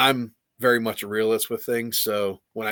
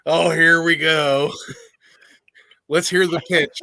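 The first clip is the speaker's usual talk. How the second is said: louder, slow sarcastic groan then laughing; wry skepticism; 6 semitones higher, 7 dB louder than usual. Retold or imitated. Sarcastic reaction to Quinn's pitch, laughing. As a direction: roll your eyes at the sales pitch.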